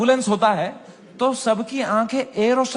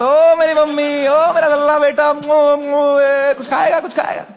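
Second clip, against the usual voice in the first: put-on higher, louder, syrupy doting baby talk; doting mom; 5 semitones higher, 5 dB louder than usual. Mother fussing over her son with food, doting coo. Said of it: "mummy के साथ".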